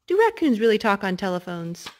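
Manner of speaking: ordinary delivery, nothing marked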